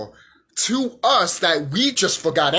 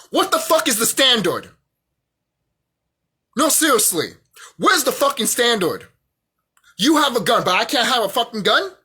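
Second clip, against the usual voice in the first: raised, indignant demand; riled up; 3 semitones higher than usual. Real, not acted. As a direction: fire off exasperated questions, voice pushed up. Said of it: "I'm getting riled up here".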